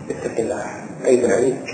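whispered plea, then soft reproach; hushed rebuke. Retold or imitated. Preacher echoing the little girl's whispered last words. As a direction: breathe the words like a final warning.

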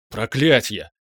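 sudden, alarmed curse; startled cry. A narrator's reading. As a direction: cry out, taken aback. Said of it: "вскрикнул паладин". (вскрикнуть испуганно)

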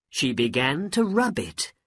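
plain reading voice

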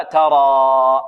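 ترى with the last alif held very long; long final vowel. Shown as a demonstration. Teacher shows pupils the verb's original form, long final vowel. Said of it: "أصله ماذا؟".

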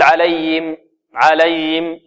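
"عليهم" with the ه lost, like "alayim"; swallowed ha. Shown as a demonstration. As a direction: mistaken demo of "عليهم" without its ha. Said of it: "لا نقول". (نطق «عليهم» خطأً بإخفاء الهاء)

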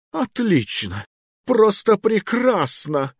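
high, slow seething sarcasm; furious sarcasm. Acted. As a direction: spit out the praise in rage. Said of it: "затрясся от гнева".

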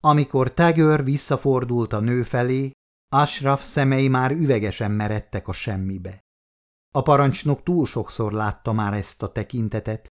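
ordinary delivery, nothing marked

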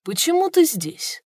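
high, slow, genuinely surprised. Acted. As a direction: react in honest surprise. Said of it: "искренне удивился генерал Хуа".

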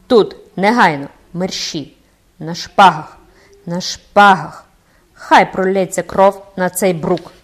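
loud, slowing shout of rage; furious challenge. A narrator's reading. Captain challenges the cloaked man to a duel, shouting furiously. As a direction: roar the duel challenge, shaking with fury. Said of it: "задихаючись од люті".

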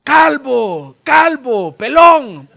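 high, loud schoolyard jeer; kids taunting. Acted. Boys on the mountain jeering at Elijah, loud mocking chant. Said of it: "le empiezan a gritar".

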